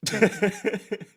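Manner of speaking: high, quick giggle; amused laugh. Real, not acted. Streamer reading a viewer comment, amused high laugh. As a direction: burst into a real, high chuckle.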